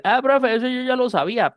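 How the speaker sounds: high, mock know-it-all student; smug student. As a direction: play the student who knew it already. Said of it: "usted va a decir". (alumno sabelotodo imitado, agudo)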